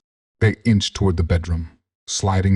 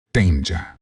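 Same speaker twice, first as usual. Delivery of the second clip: usual speaking voice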